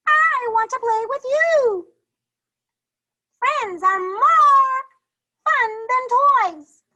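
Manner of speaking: very high, loud friendly character voices; cheerful storybook voices. Acted. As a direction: make friendship sound like pure fun. Teacher voices book characters.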